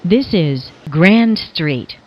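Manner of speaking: normal talking voice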